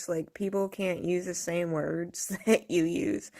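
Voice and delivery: normal talking voice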